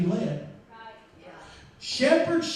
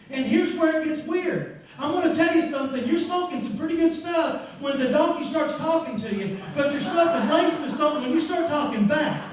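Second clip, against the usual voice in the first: normal talking voice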